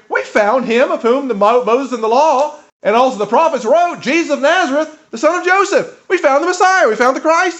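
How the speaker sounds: high, loud, rising with joy; excited announcement. Acted. Philip excitedly tells Nathanael news.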